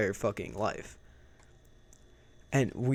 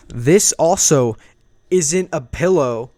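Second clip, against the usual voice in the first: shocked realization; 3 semitones higher, 10 dB louder than usual. Retold or imitated. Waking up in wrong room.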